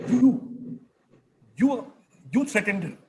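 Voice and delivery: high, stammering shocked accusation; shocked professor. Retold or imitated. Professor confronting the student in high shocked disbelief. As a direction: repeat you in disbelief, pitched high. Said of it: "very shocked and surprised".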